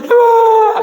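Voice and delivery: high mock child's wail; child crying out. Father imitating a crying child, high mock wail. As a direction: cry out like a fallen toddler.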